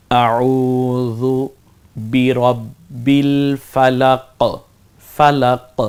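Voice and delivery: slow chanted recitation of আউযু বিরব্বিল ফালাক; drawn-out, chanted recitation; Quranic recitation. Shown as a demonstration. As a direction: recite it slowly like tilawat. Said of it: "কলকলা করে পড়তে হয়".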